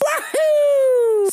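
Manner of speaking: high gleeful wahoo; excited whoop. Real, not acted. Cheering exclamation.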